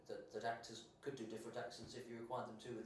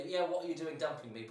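loud, raised mock-indignant retort; retold indignant girlfriend; 5 semitones higher, 11 dB louder than usual. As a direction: act out the dumped girlfriend, half laughing. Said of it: "she was just like".

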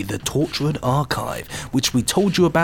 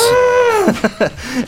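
high squeaky bat clicks; squeaky clicking bat; 4 dB louder than usual. Pretend. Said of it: "That was a wonderful noise".

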